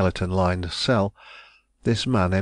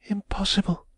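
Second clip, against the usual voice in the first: Impossible said aloud, high and falling; repeated refusal; 13 semitones higher than usual. A narrator's reading. Woman firmly repeating Impossible to Raymond, high and voiced. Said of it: "she reiterated".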